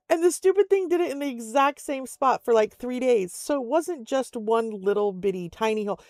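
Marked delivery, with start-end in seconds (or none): none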